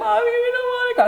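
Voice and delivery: put-on high-pitched whiny kid wail; imitated crying children. Kids begging mom not to send them away, mock crying. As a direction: mimic little kids pleading in tears. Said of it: "They're crying at this moment".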